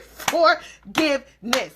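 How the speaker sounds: forgiveness said slowly, each syllable separate; syllables spelled out. Shown as a demonstration. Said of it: "That's three syllables".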